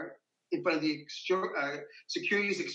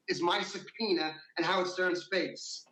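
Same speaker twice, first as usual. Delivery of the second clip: normal talking voice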